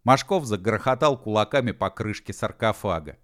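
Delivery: usual speaking voice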